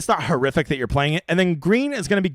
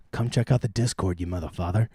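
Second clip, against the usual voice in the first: lower, softer teasing jab; playful low growl; 9 semitones lower, 6 dB quieter than usual. Acted. Plugging his Discord to viewers, low mock-menacing tease.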